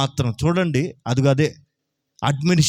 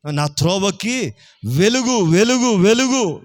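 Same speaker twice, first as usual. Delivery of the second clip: preacher's swelling repeat of Velugu; rising emphasis on light; 3 semitones higher, slower than usual. Shown as a demonstration. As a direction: build Velugu up with rising force. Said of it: "building repetition of light".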